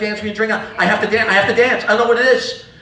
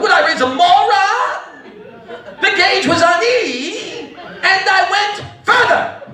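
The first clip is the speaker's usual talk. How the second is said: high, slow, drawn-out retort; mock indignant mother; 10 semitones higher, much slower than usual. Mother retorts to son about the gas, mock indignant. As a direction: stretch it out high as a scornful mom.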